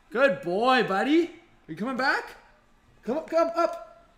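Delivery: high, sing-song coaxing to his dog. Real, not acted. Streamer coaxing his dog to come up, high sing-song call. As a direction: lift the voice and coax the pup closer.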